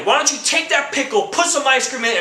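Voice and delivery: ordinary talk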